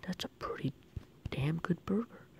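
hushed, appreciative whisper. Real, not acted. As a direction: murmur it like a secret. Whispered aside about burger.

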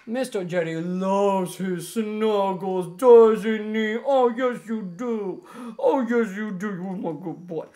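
drawn-out gushing to a pet. Real, not acted. Owner snuggling Mister Jetty, gushing baby talk. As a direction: smother the dog in mushy praise.

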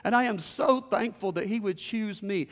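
elated preacher's testimony; joyful amazement. Acted. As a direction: exclaim it, overjoyed at being chosen. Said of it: "leapt for joy".

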